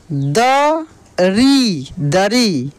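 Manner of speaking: very slow द री, then joined दरी; drawn-out spelling out. Shown as a demonstration. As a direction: sound out द, hold री long, blend into दरी. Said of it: "खींच कर किया जाता है". (द री दरी, खींच कर)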